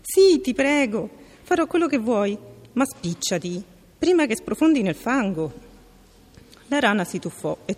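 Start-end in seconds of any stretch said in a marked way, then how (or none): 0.0-5.5 much higher and louder, eager begging; eager pleading. A narrator's reading. Princess begs the frog to hurry, excited. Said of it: "felice, batté le mani".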